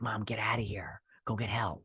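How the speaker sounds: unvoiced hushed urging; whisper. Son hiding, whispering to his mother. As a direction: whisper so the orcs can't hear.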